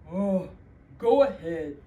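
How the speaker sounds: grudging troll letting him pass. Acted. Troll letting the little goat pass, loud and gruff. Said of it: "said the troll".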